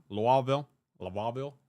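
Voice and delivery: hammed-up Southern way of saying the city; imitated Southern accent. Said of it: "what accent I'm imitating".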